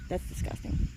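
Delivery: a breathy whisper of disgust. Real, not acted. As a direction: mutter it, repulsed by the weeds. Weedy patch, speaker repulsed.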